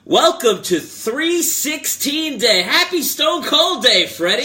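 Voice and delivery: high, loud, drawn-out hype shout; celebratory show-opening yell; loud intro. Acted. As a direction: holler it like a wrestling announcer. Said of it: "Loudest intro of all time".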